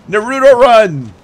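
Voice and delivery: high, loud battle cry; excited shout. Pretend. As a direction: yell it like a hype anime move. Announcing his silly run.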